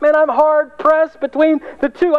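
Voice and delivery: Paul voiced high and full of delight; excited Paul. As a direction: play Paul torn by two wonderful choices. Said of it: "He's like".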